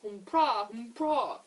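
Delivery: loud imitated trumpeting that swoops; elephant trumpet. Reader voicing the great big elephant, loud trumpeting. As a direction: blare it out like an elephant.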